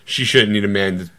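normal talking voice

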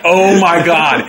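slow, laughing oh my God; mortified laugh through the words; laughing disbelief. Retold or imitated. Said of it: "And I'm like".

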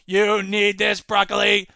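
loud, even robot voice; a lightly voiced household robot. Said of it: "Bob the robot".

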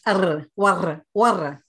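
imitating students' rolled R in "warr"; "Arr, warr" with the R trilled on the tongue. Accent coach imitating Spanish and Hindi students, rolled R. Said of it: "always roll their Rs".

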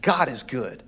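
ordinary delivery

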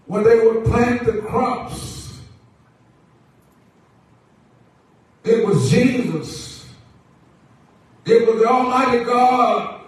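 projected sermon cadence in short, held phrases; preaching. Acted. Preacher assures the church that the Lord always provides, preaching. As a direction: stretch key words, then pause for the congregation.

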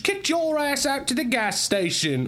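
high, loud mock Cockney; terrible Cockney. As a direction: belt out a hammed-up Cockney taunt. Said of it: "You're doing a terrible accent".

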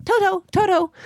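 high silly dog voice saying its name. Retold or imitated. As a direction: squeak the name like a cartoon dog. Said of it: "Toto doesn't go around saying".

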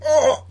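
sudden high-pitched oh of pain; imitated yelp. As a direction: yelp it high like you were just jabbed. Imitating the dude's sound.

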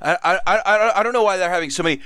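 tripping over words, sloshed; drunk slurring. Retold or imitated. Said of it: "slurring her words".

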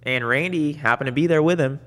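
usual speaking voice

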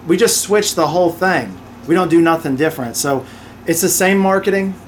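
ordinary talk

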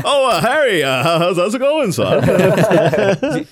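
high, loud caught-out dad; startled cover-up greeting, high and loud; flustered father. Retold or imitated.

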